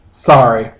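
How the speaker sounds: ordinary talk, nothing marked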